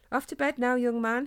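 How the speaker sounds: mother firmly sending her son to bed. A narrator's reading. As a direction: send him to bed, no arguing. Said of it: "his mother replied firmly".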